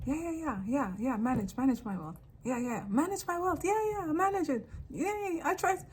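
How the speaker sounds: gullible client. Retold or imitated. Clowns handing over their wealth, mock eager agreement. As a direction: imitate someone agreeing without thinking. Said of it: "And they're like".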